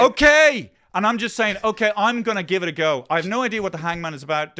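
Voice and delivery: loud exasperated outburst; exasperated shout. Real, not acted. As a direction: snap it out, fed up and loud. Host snaps back at interruptions.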